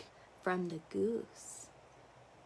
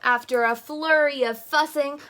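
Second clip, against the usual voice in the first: ordinary delivery, nothing marked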